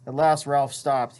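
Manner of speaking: ordinary delivery, nothing marked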